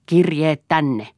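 grabbing wife, demanding the letters; menacing demand. Acted. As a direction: drag each word out as a threat. Eero grabs her, demands letters.

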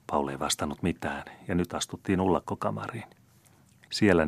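ordinary delivery

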